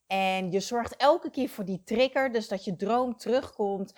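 usual speaking voice

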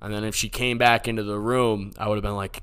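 ordinary talk

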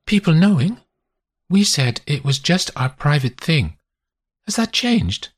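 lightly voiced woman, wary; a lover questioning what has changed; Constance, uneasy.

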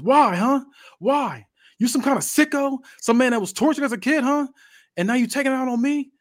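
high, loud, strained questions; anguished confrontation. Acted. Jeff stands over his fallen tormentor demanding answers, anguished anger. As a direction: hurl accusing questions at your attacker, pitch way up.

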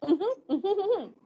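high impatient uh-huhs; impatient mock humming. A believer hums impatiently at God, mocking. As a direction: hum along as if barely listening. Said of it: "y uno es como que".

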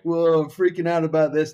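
high, loud panicky parent voice; a hammed-up worried parent; mock freaking out. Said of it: "with all the parents just like".

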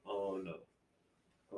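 normal talking voice

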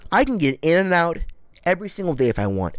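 ordinary delivery

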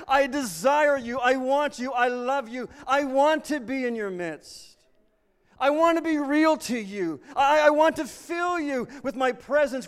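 high, loud, slow fervent declaration; passionate declaration. Acted. Preacher voicing God to his people, fervent and loud. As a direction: pour it out with burning longing. God's passionate reply voiced.

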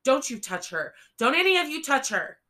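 panicked threat, rising to a shriek; frantic shout. A narrator's reading. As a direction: scream it to keep them away. Boy threatening armed police.

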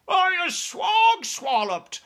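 very high, loud, slow bellowing shout; bellowing giant. Acted. Bound giant bellows at his captors, loud and very high. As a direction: bellow with everything you have. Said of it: "bellowed the bone cruncher".

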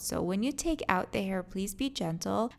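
ordinary delivery

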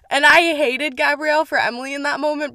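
ordinary talk

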